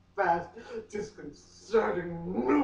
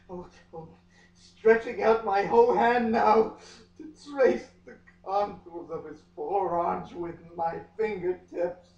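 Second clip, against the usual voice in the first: usual speaking voice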